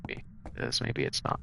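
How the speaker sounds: whispered aside to herself; whispering. Real, not acted. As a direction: breathe it out under your breath. Muttering to self while searching.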